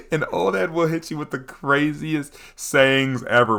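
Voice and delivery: ordinary talk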